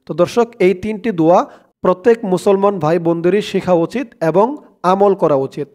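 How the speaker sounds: ordinary delivery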